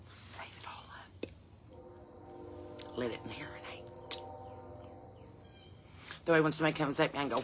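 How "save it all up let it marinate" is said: slow, very soft whisper; whispered warning. Acted. Woman hints at saving up her temper, whispering slowly. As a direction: whisper it low and drawn out.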